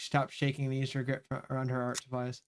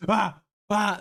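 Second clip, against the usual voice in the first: mock lunging cries; 6 semitones higher, 5 dB louder than usual. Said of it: "They're like".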